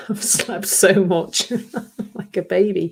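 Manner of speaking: giggling through the words; laughing. Real, not acted. Laughing to listeners about how much she slept. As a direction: laugh it out, amused at herself.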